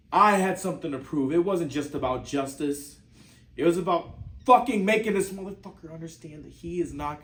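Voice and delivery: loud, heated, emphatic insistence; angry outburst. Acted. Acted monologue about father's killer.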